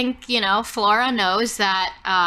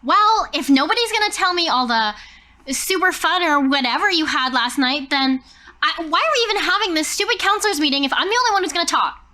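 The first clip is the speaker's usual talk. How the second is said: higher, louder, fast frustrated rant; frustrated outburst; 6 semitones higher, 3 dB louder than usual. Acted. Flora slams her tray and scolds the silent counselors, frustrated. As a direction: vent it all in one rushing huff. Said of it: "a classic Flora scene".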